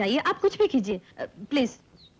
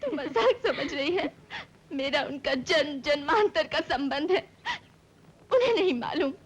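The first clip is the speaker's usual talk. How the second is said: tearful, heartbroken insistence; crying; 3 semitones higher than usual. Acted. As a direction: protest through sobs. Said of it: "इसमें रोने की क्या बात है".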